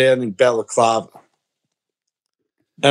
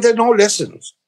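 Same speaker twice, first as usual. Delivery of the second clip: higher-pitched mock Chinese shopkeeper accent; 6 semitones higher than usual. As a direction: imitate the shop owner's Chinese accent. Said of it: "if you do an accent of a foreign person".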